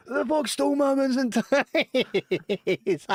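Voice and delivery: high, slow, drawn-out furious yell; outraged yell. Retold or imitated. Colleague yelling across the call centre for his stolen lunch, high slow outrage. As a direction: bellow it across the office. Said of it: "you hear from across the way".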